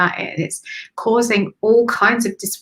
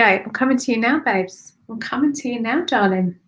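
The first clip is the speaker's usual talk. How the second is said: usual speaking voice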